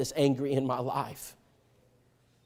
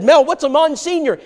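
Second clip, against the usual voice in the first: far higher and louder, wide-eyed; eager surprised question; 11 semitones higher, 6 dB louder than usual. Retold or imitated. Preacher's younger self asking his friend, eager. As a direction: ask it bursting with curiosity.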